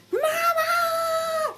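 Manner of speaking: put-on very high, drawn-out toddler wail; little boy calling. Little boy wakes mother at five fifteen, high imitated wail. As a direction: imitate a toddler crying out for a parent. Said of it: "My little guy woke me up".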